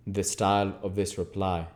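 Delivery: ordinary delivery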